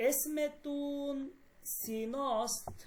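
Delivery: very slow, syllable by syllable; slow model phrase. Shown as a demonstration. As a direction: say এসমে তুন slowly, spacing each syllable. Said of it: "দুনিয়া বলতেছে". (ধীরে ধীরে ভেঙে ভেঙে উচ্চারণ)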